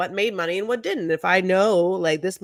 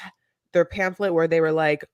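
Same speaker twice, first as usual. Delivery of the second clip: normal talking voice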